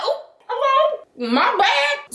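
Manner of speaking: high, drawn-out startled greeting; taken aback. Acted. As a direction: squeak out a surprised, apologetic reply. Acts out being caught off guard.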